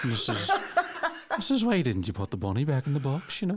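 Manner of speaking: a mild Irish lilt, low and gentle. Retold or imitated. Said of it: "[name] in Con Air".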